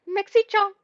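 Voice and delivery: high-pitched mock Japanese accent. Shown as a demonstration. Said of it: "like, a Japanese accent".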